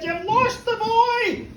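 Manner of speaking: high, loud, drawn-out outburst; livid wife. A narrator's reading. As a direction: scold your husband at a high pitch, furious. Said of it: "the wife is livid".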